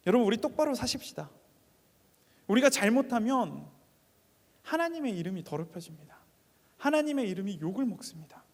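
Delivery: rising, forceful call to the congregation; fervent preaching. Acted. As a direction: urge them loudly to live upright. Exhortation at full force. (회중에게 힘주어 외치는 설교)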